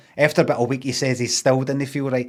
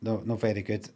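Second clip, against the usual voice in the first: low weak reply; 3 semitones lower, 8 dB quieter than usual. Retold or imitated. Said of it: "He went".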